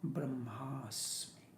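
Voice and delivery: "Brahmasmi" slow, soft and falling; drawn out Brahmasmi. Shown as a demonstration. Teacher showing "Brahmasmi" drawn out after the short "Aham". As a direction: stretch "Brahmasmi" out gently.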